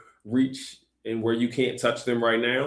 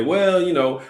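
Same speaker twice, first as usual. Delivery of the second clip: higher-pitched mimicry of an objector; mock excuse-making; 7 semitones higher, 4 dB louder, slower than usual. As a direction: lift "well" high, like someone dodging the point. Mimicking people who dodge responsibility.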